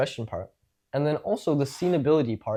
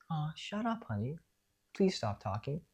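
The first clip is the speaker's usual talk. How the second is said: much softer, higher, gentle and affectionate; sweet teasing; 3 semitones higher, 11 dB quieter than usual. Shown as a demonstration. Parent hushing a child softly and fondly. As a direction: say it like a loving joke to a child. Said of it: "so it's not like".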